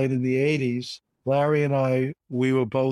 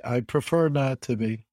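usual speaking voice

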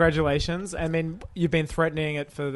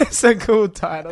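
mocking echo; 3 semitones higher, 5 dB louder, slower than usual. Mocking echo of friend's phrase.

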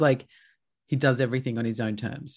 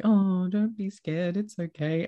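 soothing a dog; 7 semitones higher than usual. Retold or imitated. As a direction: coo it as to a frightened pet. Soothing her frightened dog, acted out.

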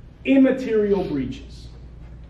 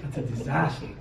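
mock deadpan disbelief; 4 semitones lower, 11 dB quieter than usual. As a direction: act unimpressed, dryly. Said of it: "I said".

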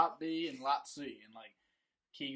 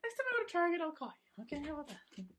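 high squeaky voice; 13 semitones higher than usual. Acted. Joking high voice.

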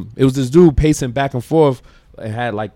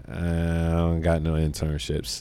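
slow, softer mumble past a straw in the mouth; talking round a straw; 5 semitones lower, 6 dB quieter than usual. Retold or imitated. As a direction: say it slowly, like a straw is stuck in your mouth. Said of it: "mad spit came out when he said it".

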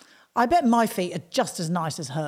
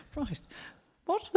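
normal talking voice

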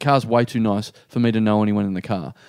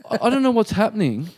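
high, bewildered, nervous stammer; freaked out; 7 semitones higher than usual. Retold or imitated. Man baffled by a celebrity being super nice, freaked out. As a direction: retell your own fluster in a raised pitch. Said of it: "getting really freaked out".

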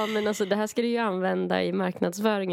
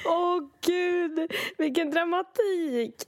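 mock dramatic; 8 semitones higher, slower than usual. Real, not acted. Friend reacts to story.

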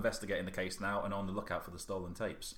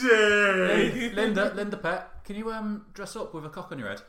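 character voice; 8 semitones higher, 8 dB louder, slower than usual. Pretend. Voicing a character calling out.

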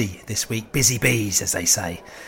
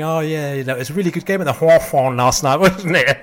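teasing. Retold or imitated. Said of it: "we were just going".

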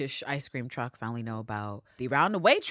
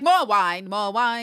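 1 Cersei wine demand; 4 semitones higher, 6 dB louder, much slower than usual. Retold or imitated. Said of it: "She pulls a Cersei".

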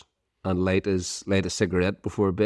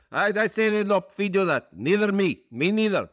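incredulous Turkish owner; 12 semitones higher than usual. Retold or imitated. Owner scoffs at student paying, high accented disbelief. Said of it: "He says".

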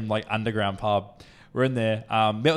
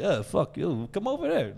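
higher-pitched imitation of a Russian guy; Russian accent; 6 semitones higher than usual. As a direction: play the pushy Russian with a put-on accent. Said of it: "some Russian guy comes up to me".

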